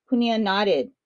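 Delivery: ordinary delivery, nothing marked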